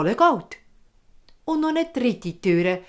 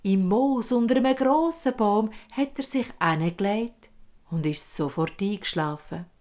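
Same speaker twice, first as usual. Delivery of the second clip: ordinary delivery, nothing marked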